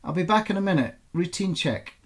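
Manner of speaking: calling out. A narrator's reading. Elena reassuring her daughter from afar, called out loudly. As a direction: call it out so it reaches across the room. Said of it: "Elena called".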